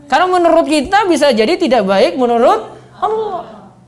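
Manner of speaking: ordinary talk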